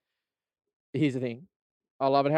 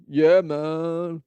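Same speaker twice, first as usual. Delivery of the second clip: louder, slurred lazy reply; hungover staffer; 3 semitones higher, 5 dB louder than usual. Retold or imitated. Staffer fresh off a bender, lightly voiced. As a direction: sound wrecked and lazy. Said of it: "coming off a bender".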